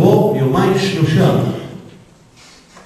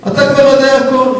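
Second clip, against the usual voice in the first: higher-pitched, insistent repeat; raised-pitch retort; 6 semitones higher than usual. Real, not acted. Repeats retort over a heckler.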